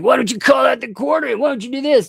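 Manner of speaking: high, fast, whiny mock voice of the critic; mocking whiny imitation. Streamer mocking a critic's demands in a high whine. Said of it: "And he's like".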